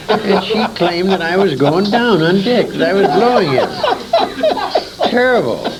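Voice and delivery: laughing while telling. Real, not acted. Old man laughing as he tells the interviewer the accusation. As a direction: chuckle your way through the scandal. Scandalous story told through laughter.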